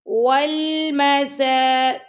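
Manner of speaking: slow, drawn-out melodic tajweed recitation; Quran recitation. Shown as a demonstration. Teacher reciting the verse slowly to students, melodic chant. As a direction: chant each word long and measured.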